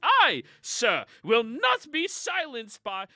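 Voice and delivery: loud, high, slow protest; indignant outburst. Acted. Spaulding protesting loudly to the Furbolg, indignant. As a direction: refuse to be silenced, full volume.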